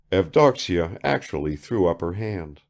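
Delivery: plain reading voice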